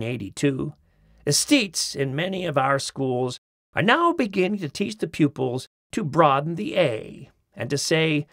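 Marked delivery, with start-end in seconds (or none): none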